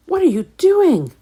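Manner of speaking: high, loud yell over banging. Acted. Pete yells at Tom over the banging, high and loud. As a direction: shout to be heard over the hammering. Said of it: "Pete yelled above the noise".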